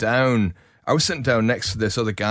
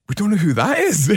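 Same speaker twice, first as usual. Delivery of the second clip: higher-pitched mock bafflement; exasperated repeat; 8 semitones higher, 4 dB louder than usual. Band still stumped by [name]'s impersonation, mock exasperation. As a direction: say it higher, hamming up the cluelessness.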